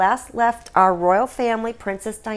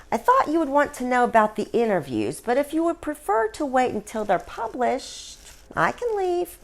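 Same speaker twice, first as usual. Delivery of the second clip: Diana's voice; 6 semitones higher than usual. Acted. Diana's ghost voiced in story.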